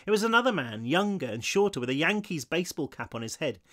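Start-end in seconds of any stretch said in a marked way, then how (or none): none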